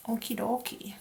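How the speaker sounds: light Minnesotan lilt; a touch of Minnesotan accent, casual; little Minnesotan accent. Real, not acted. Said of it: "like, a little Minnesotan accent".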